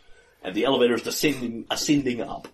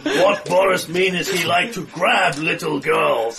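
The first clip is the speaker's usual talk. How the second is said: broken English brute; 6 semitones higher, 5 dB louder than usual. Acted. GM voices Boris broken English.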